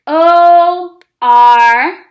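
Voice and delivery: "O R" called out loud, letter by letter; teacher spells "or" together with the class; spelling aloud. Shown as a demonstration. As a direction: shout out each letter. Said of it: "Now spell it with me".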